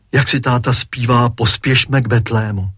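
ordinary delivery, nothing marked

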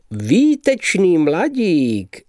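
high, slow, delighted; enthusiastic exclamation. Acted. Mr Justic praises the youth, high and slow with delight. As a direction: gush over the young man. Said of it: "zvolal nadšeně pan Justic".

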